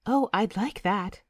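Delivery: lightly voiced shy bride-to-be; a touch of blushing pleasure; bashful girl. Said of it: "She blushes a little".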